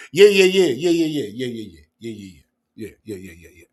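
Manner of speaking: mimicking rapid-fire yeahs that fade away; mocking repeated yeah. Host mimicking a caller's repeated yeahs, mocking and fading out. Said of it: "yeah, yeah, saying things three times".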